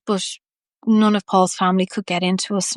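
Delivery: usual speaking voice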